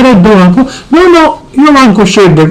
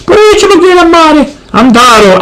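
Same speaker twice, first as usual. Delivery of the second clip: ordinary talk, nothing marked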